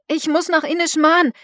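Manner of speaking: high, loud shout over the storm; shouting against wind. A narrator's reading. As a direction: yell it into the gale. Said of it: "schrie Miranda gegen den Wind". (laut gegen den Wind geschrien)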